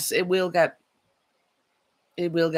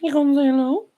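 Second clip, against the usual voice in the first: pet talk; 11 semitones higher, 7 dB louder than usual. Real, not acted. Coaxing her puppy to say hello, high baby talk. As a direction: coax the dog up high and sweet.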